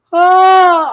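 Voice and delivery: long, high, loud wail; mock eerie cry to scare; imitated spooky wail. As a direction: howl it out like a ghost. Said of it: "I hear my dad going".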